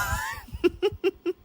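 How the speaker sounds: high, soft wheeze of laughter; wheezing laugh. Real, not acted. Close to crying over sister's dance, wheezing laugh. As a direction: let the laugh thin into a wheeze. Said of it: "Sorry for the wheezing into the laugh".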